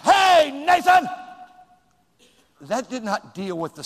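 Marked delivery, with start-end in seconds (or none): 0.0-1.1 sharp, loud, higher call across the room; teacher yelling at a talking boy; shout. Shown as a demonstration. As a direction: bark his name to show the wrong way. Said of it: "I'm not gonna say".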